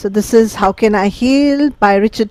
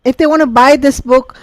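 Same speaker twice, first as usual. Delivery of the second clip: ordinary talk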